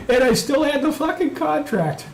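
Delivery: laughing boast. Real, not acted. Gloating laugh repeat.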